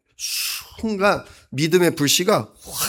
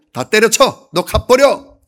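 mock furious yell, higher and louder; angry outburst; 6 semitones higher, 6 dB louder, slower than usual. Jesus as he did not speak, a pretend furious yell. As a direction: bark it out like an offended master. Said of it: "화가 났다고".

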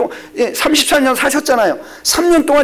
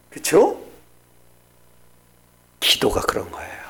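ordinary talk

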